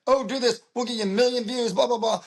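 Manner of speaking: mocking a slick TikTok marketer's promise; mock sales pitch. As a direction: parody the hype of online promoters.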